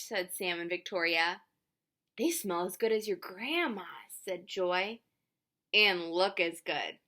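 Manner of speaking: lively picture-book reading with voiced characters; reading to children. A narrator's reading. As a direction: read it out warmly, playing each child.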